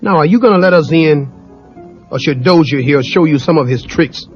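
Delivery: angrily. Acted. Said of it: "Mister T said angrily".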